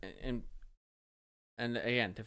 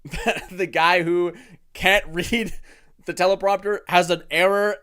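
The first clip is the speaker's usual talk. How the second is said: laughing mockery; 6 semitones higher, 14 dB louder than usual. Real, not acted. Mocking Trump teleprompter gaffe.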